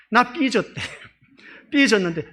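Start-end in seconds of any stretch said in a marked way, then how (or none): 0.1-0.7 higher, louder mock sulky child. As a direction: pout it like a sulking girl. Voicing the sulking girl. (높게 흉내 낸 삐진 아이 말투)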